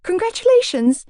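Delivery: high, rushed blurt. A narrator's reading. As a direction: blurt it out nervously. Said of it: "I blurted out instead".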